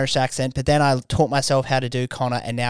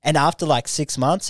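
ordinary talk, nothing marked

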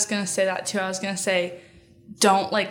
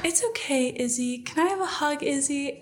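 gentle comforting voice; 6 semitones higher than usual. Retold or imitated. Jordan voiced comforting her friend.

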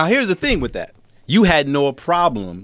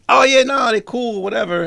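breezy brush-off; 9 semitones higher than usual. Retold or imitated. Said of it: "I just be like".

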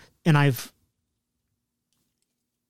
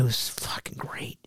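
whispering; 5 semitones lower, 13 dB quieter, much slower than usual. Real, not acted. Confessing the salsa was great.

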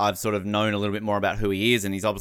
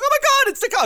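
shrieking much higher and louder fangirl; starstruck fan; 6 dB louder, much faster than usual. Pretend. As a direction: squeal at the star in the street. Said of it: "I'm like".

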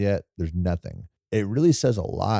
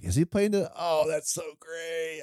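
high, hushed reenacted delight; delighted reaction; 10 semitones higher, 6 dB quieter than usual. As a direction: act out a quietly thrilled viewer. Acting own delighted reaction to film.

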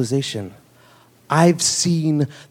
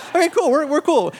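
much higher, faking calm; feigned cool, pitched way up; nervous okay; 11 semitones higher than usual. Retold or imitated. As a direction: squeak out a nervous reassurance. Narrator reacts nervously to surgery.

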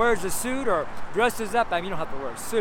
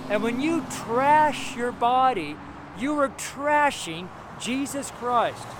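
full-force preaching; 4 semitones higher, slower than usual. Acted. Street minister preaching hard to believers about their bodies.

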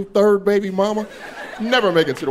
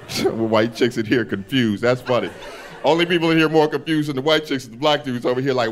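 normal talking voice

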